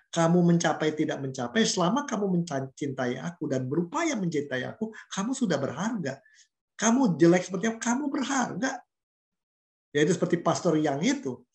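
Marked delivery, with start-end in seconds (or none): none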